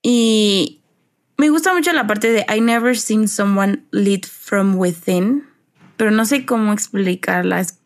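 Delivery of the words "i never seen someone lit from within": slow, dreamy English quote; slow lyric quote. Retold or imitated. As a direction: draw the lyric out slowly. Quoting English lyric slowly.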